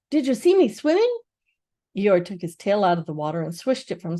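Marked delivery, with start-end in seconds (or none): 0.1-1.2 high, loud eager question; excited squeak. A narrator's reading. Roo asking everyone excitedly about his swim. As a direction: burst with pride as a tiny joey.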